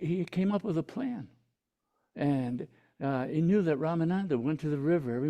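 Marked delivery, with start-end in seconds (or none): none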